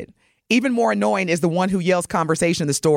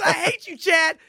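quoted mock yell; 10 semitones higher than usual. Quotes cohost's mimicry while laughing.

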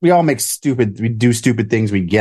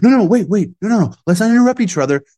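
mocking the mayor; 8 semitones higher, faster than usual. Host mocking the mayor cutting reporters off, mocking. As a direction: ham up his interruption. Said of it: "he cuts you off".

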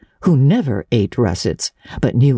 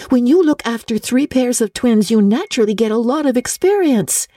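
higher, bright reassurance to a crying friend; cheery comfort; 8 semitones higher than usual. Acted. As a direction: cheer her friend up lightly. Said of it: "said Anne cheerily".

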